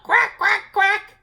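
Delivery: high, loud duck quacks; cow quacking. A narrator's reading. Cow trots up quacking, high and loud. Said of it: "Cow trotted up".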